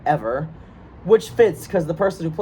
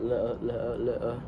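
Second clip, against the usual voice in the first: low, soft Cockney "le-uh" drill; murmured Cockney glottal stops, t dropped; Cockney warm-up; 6 semitones lower, 10 dB quieter, much slower than usual. Shown as a demonstration. Actor getting into the Cockney mindset, low warm-up. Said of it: "get into the Cockney mindset".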